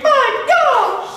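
high shrieking gasp of alarm; high frightened mother's cry; mother's scream. Acted. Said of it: "the mother screamed".

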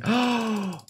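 sharp, high gasp of wonder; real gasp of surprise; amazed gasp. Real, not acted. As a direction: catch your breath at the sight. Amazed at glowing fish.

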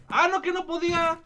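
usual speaking voice

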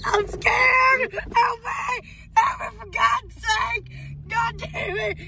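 high, frantic yelling for help; panicked cries for help. Acted. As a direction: scream for help as he's attacked. Being attacked, cries for help.

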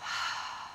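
long breathy sigh out the mouth; dramatic sigh. Shown as a demonstration. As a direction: let it all go in one big sigh. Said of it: "let's take a big sigh out through the mouth".

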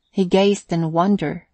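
ordinary delivery, nothing marked

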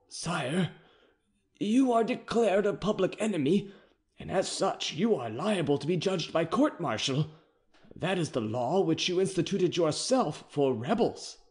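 captain reading the charge, uneasy; anxious captain. A narrator's reading. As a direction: deliver the hard law nervously. Captain voice, higher.

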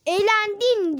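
high, loud mocking voice; mock quote. Kid mocking the game's taunting message, high and loud. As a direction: squeal it like a taunt.

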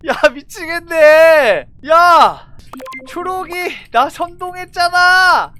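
loud exasperated shout; frustrated yelling. Real, not acted. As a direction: yell in disbelief.

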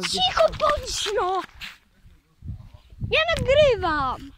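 slow, high, exasperated; annoyed hush. Real, not acted. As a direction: tell her off for spoiling the video. Hushing grandma while recording. (zirytowane uciszanie)

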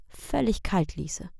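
usual speaking voice

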